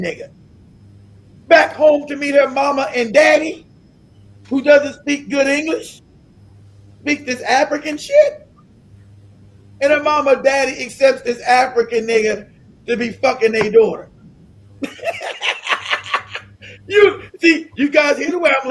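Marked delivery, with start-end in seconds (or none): none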